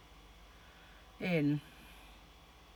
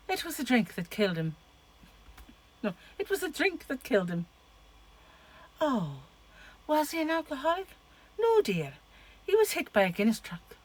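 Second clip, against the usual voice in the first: attempted Irish accent, wobbling; muddled Irish accent; 6 semitones higher than usual. Vlogger quoting two movie characters, muddled Irish accent. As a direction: put on a shaky attempt at Irish. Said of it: "getting my accent to muddle up".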